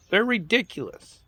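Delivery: squeaky, high-pitched scorn; high scornful exclamation. Real, not acted. Scorn at politicians.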